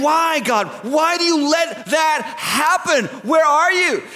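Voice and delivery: high, slow, insistent questioning of God; anguished demand. Acted. Person watching terrible news demanding answers of God, anguished. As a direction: cry out for an answer, stretching the words. Acted cry to God over evil.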